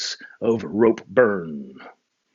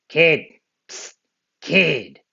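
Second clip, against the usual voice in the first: gruff voice; 6 semitones higher, 4 dB louder, much slower than usual. Acted. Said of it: "a gruff voice said".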